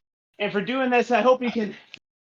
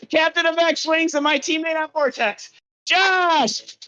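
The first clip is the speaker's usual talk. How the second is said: high-pitched build to a called-out name; big raised-pitch guest reveal; announcer introduction; 7 semitones higher, slower than usual. Acted. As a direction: announce them like a ring emcee.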